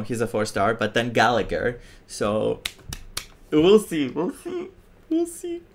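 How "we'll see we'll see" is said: high soft teasing squeak. Real, not acted. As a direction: tease it in a tiny voice.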